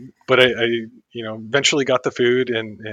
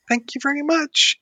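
much higher, sing-song sweet thanks; sugary thank you. Retold or imitated. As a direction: kill him with kindness, way up high. Bouncing cheerfully up to rude clerk.

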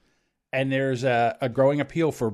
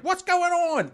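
high, drawn-out call; mock calling out; slower than usual. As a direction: imitate a parent calling from the couch. Parent calls out without getting up.

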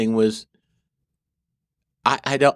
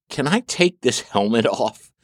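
ordinary delivery

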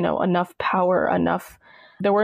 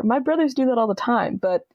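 ordinary talk, nothing marked

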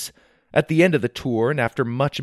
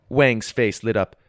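ordinary delivery, nothing marked

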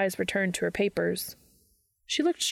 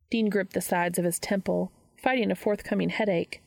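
ordinary delivery, nothing marked

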